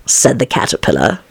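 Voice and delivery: usual speaking voice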